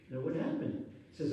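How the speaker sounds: ordinary talk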